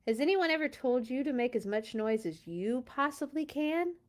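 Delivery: ordinary delivery, nothing marked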